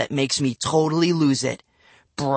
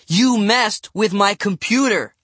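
accusing; 7 semitones higher, 4 dB louder than usual. A narrator's reading. Angry accusation in quarrel.

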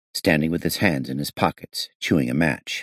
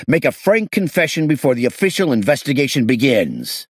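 raised, pressing, higher voice; loud interrogation; 9 semitones higher, 4 dB louder than usual. Acted. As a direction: hammer the suspect with threats. Detective pressures suspect after shouting.

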